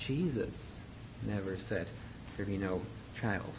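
"Jesus" picked out before a pause; emphasis on JESUS. Shown as a demonstration. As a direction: lean on "Jesus", pause, then drop down for "never said there be no trials". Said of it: "put a little bit emphasis on the pronunciation of".

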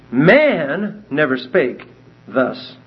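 "Man" drawn out and hit hard, the rest slow; slow, weighty read with "Man" stressed. Shown as a demonstration. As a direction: lean on "Man", then ease off through "never spake thus". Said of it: "the emphasis is on the word man".

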